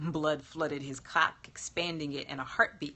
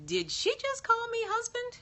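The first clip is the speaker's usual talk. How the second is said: shocked. Acted. Shocked, +17 far.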